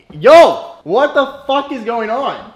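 loud, high startled yell; startled shout. Real, not acted. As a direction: shout in shock. Startled by head kiss.

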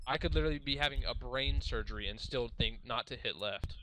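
normal talking voice

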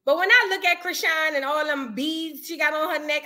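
ordinary delivery

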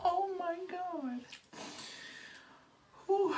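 oh my God squeaked very high and slow; a stunned, high-pitched gasp of disbelief; shocked squeal. Real, not acted. Shocked at her own makeup.

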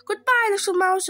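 high, loud frightened goodbye; panicked farewell. Acted. Fox runs from mouse and Gruffalo, panicked.

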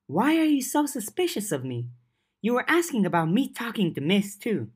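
lightly voiced young girl, stung and defensive; Verona voiced lightly. Verona pushing back at Lucy's suspicion, lightly voiced and defensive.

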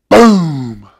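a big booming thunder word; loud boom. Acted. Reading the book title to children, loud thunder boom.

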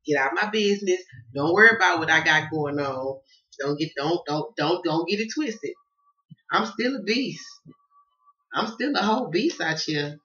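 loud, insistent, don't-get-it-twisted; defiant loud rant. Acted. Telling nosy people to back off, loudly defiant. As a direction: push it out hard and proud.

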